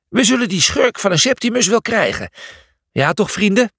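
rally the troops; eager hero stirring up his pals; rallying cry. Acted. Julius rallies his friends.

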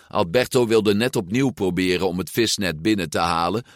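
plain reading voice

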